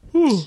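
big sudden yawn, high and loud. Real, not acted. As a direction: let out a huge yawn. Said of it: "to yawn like that on the show".

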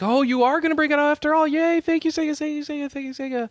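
high, gushing fan cheer; excited fans. Retold or imitated. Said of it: "and everyone's like".

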